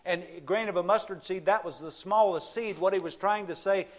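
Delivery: fervent sermon climax; preaching at full force. Acted. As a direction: drive the point home from the pulpit. Preaching passage raised pitch.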